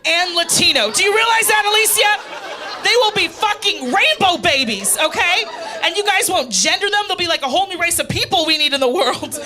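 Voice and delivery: loud, high, excited mock rant; mom flipping out. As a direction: blast it like an overjoyed mother losing it. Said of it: "this is a real reenactment of her reaction".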